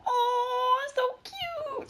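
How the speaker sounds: very high squealing coo; cooing over cute animal. Retold or imitated. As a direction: gush like seeing a puppy. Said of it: "we both go".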